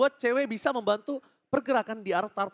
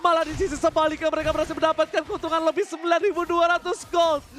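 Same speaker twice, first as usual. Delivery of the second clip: ordinary talk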